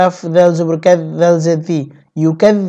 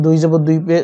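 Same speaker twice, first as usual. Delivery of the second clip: ordinary delivery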